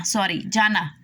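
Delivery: ordinary talk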